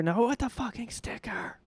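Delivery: high-pitched comic outrage; mock flipping out. Voter freaking out over a sticker, mock rage. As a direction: jump up high in sudden mock fury. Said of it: "somebody flipping out".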